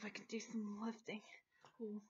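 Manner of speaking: a playful whispered brag about lifting books; whispered joke. Pretend. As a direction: sly whisper aside.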